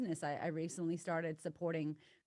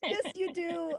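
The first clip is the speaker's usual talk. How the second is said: excited; 11 semitones higher, 4 dB louder, slower than usual. Real, not acted. Guest still thrilled.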